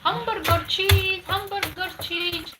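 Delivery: put-on high, slow sing-song child voice; imitating her little son. Mother reliving her son's cartoon phrase, high slow child voice. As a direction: stretch it out like a small boy chanting. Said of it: "Who was, who was saying like that?".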